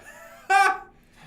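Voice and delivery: sudden high, loud mock guffaw; imitated laugh. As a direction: imitate someone bursting out laughing. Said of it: "all I heard was, like".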